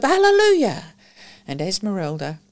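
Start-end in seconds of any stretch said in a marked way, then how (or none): 0.0-0.9 high, loud exclaimed hallelujah; fervent cry. Acted. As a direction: shout it with revival fervour. Ejaculations from prayer meeting.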